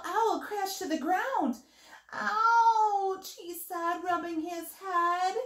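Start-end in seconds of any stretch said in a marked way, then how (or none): 2.1-3.4 high, loud cry of pain; acted "ouch". A narrator's reading. Little Owl has crashed to the ground, a high loud yelp. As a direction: yelp it out for a storybook bump.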